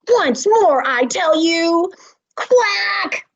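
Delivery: angry. Acted. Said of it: "Madam Duck angrily".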